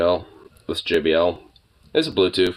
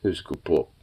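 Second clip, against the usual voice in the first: pet talk; 9 semitones lower, 12 dB quieter than usual. Real, not acted. Owner croons praise at her puppy. Cooing at the dog.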